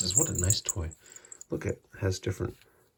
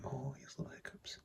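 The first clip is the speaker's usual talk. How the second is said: soft whispered aside; 5 semitones higher, 17 dB quieter than usual. Real, not acted. Owner whispering an aside about her cat's hiccups. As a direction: whisper it so the cat isn't disturbed.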